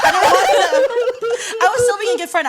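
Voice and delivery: laughing through the words; high, loud laughing speech. Real, not acted. Laughing while retelling morning.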